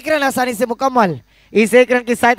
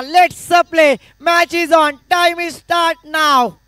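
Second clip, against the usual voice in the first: umpire call; 3 semitones higher, 3 dB louder, much slower than usual. Retold or imitated. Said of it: "साक्षात umpire का इशारा".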